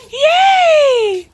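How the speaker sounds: high, loud, tearful cheer; crying cheer of joy; joyful yell. Real, not acted. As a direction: shout out the happiness. Celebrating the reunion.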